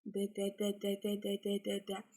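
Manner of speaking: «Д, д, д» tapped out as crisp separate d's; rapid repeated d sound. Shown as a demonstration. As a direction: drum the tongue: «д, д, д». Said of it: "и произносим звук «д»".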